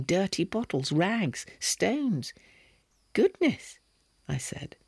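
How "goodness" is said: high startled exclamation; surprised. A narrator's reading. Narrator startled by Slavkin's room, high surprised gasp. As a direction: blurt it out in shock, pitched high.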